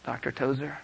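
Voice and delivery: softly retold, tentative approach; quiet, careful interruption; hushed interruption. Woman softly interrupts Doctor Tozer, hushed retelling.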